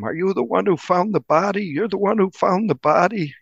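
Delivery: high, teasing schoolkid voice; mimicking curious kids. Reporter mimics high school kids asking him about the body, high and teasing. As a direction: echo the classmates' nagging question up high. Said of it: "kids would ask him".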